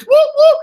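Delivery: very high, loud imitated siren; police siren whoop. Imitating the police being called, siren whoops. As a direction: whoop like a squad car. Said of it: "That's the sound of the police".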